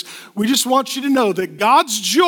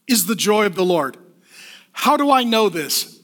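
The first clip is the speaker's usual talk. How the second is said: ordinary delivery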